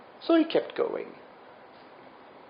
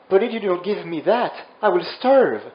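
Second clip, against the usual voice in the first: higher, louder plea; protesta accorata; 5 semitones higher, 5 dB louder than usual. Acted. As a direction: plead loudly, pushing the last word. Hungry character pleads in story.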